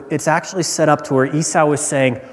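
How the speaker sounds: ordinary delivery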